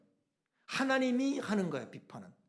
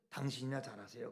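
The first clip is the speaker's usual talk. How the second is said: low, hushed quoted retort; cool, understated quote, much softer than preaching; famous-line delivery; 8 semitones lower, 11 dB quieter than usual. Acted. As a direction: repeat the put-down low and softly. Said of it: "그러는 거예요".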